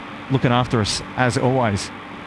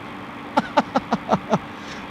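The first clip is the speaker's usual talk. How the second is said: excited laugh; 10 semitones higher, 5 dB quieter than usual. Real, not acted. Laugh while spotting plane.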